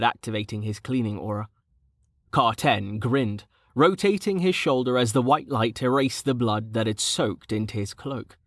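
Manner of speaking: usual speaking voice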